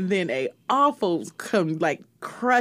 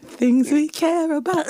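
put-on lighter British accent take, a bit higher; British accent again; 6 semitones higher than usual. Co-host repeats the title in a British accent on request. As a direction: give the British accent one more time. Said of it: "Give me the accent one more time".

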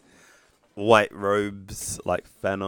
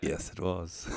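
quiet answer, well below normal volume; soft, subdued reply; hushed acted reply; 3 semitones lower, 11 dB quieter, slower than usual. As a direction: answer softly, as the hero in an imagined scene. Acts hero's reply to dog.

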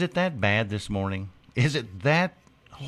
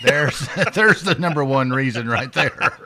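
laughing; 4 semitones higher, 5 dB louder than usual. Real, not acted. Laughing at fridge joke.

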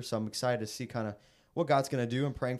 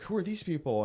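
higher-pitched imitation of a shocked woman; mock bewildered question; 7 semitones higher than usual. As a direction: retell a taken-aback question, pitch jumping up. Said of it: "she looked shocked and was like".

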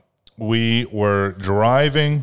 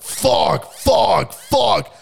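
imitated yelling; 12 semitones higher, much slower than usual. Driver yelling in his car after the crash. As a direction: yell it while pounding the wheel. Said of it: "you could tell he's yelling".

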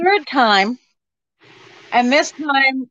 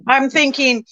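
ordinary delivery

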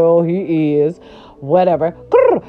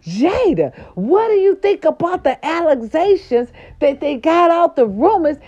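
reporters calling after Jada, a touch higher; 7 semitones higher than usual. Retold or imitated. Paparazzi calling to Jada outside the restaurant, mild mock shout. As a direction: chase her with the question. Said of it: "they said".

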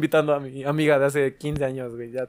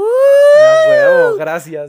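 high, slow, cracking with mock emotion; hammed-up tearful thanks; mock tearful joy; 12 dB louder, much slower than usual. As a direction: overdo a weepy happy thank-you. Mock overjoyed child thanking.

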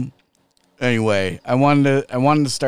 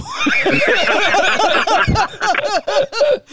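high, loud laughing shriek; real howl of laughter; 6 dB louder than usual. Real, not acted. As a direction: crack up loudly. Laughing hard at bus stop story.

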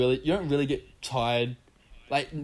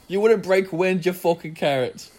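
mock taunt; 5 semitones higher, 4 dB louder than usual. Reading a comment insult aloud.

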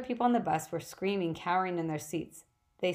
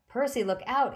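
higher, louder warning call; urgent cry; 6 semitones higher, 4 dB louder than usual. Acted. Annabeth warns Percy of the whip, crying out. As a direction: warn him sharply. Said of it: "Annabeth cried".

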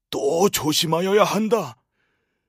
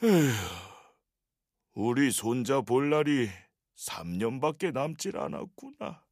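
weak and sad; 7 semitones lower, 7 dB quieter than usual. Acted. Sick grandfather sighs to grandson.

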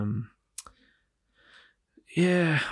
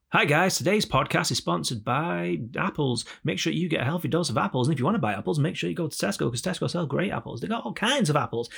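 higher, sing-song mock sponsor read; podcast ad read; 5 semitones higher than usual. Host plugging apples to bored listeners, mock podcast ad read. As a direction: read it like a padded sponsor plug. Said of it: "start filling you with ads".

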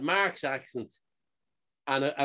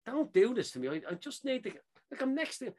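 higher, softer retold pleading; exasperated plea; 8 semitones higher, 4 dB quieter than usual. Traveller held up at the gate over his bag, exasperated. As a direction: plead under pressure, pitch climbing.

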